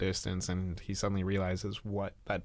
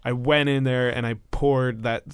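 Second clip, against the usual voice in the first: louder, higher raging yell; screaming in rage; 4 semitones higher, 8 dB louder than usual. Acted. Ron screaming at the creature he thought was his mother, raging yell. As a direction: scream it at her with the hurt boiling over. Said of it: "screaming at this alien creature".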